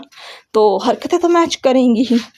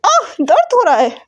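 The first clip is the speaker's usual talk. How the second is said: crying out in pain; 7 semitones higher than usual. A narrator's reading. Yang Yang crying out as Yan Li pulls her hair, high yelp. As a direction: yelp as your hair is yanked. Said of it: "यांग चिल्लाती है".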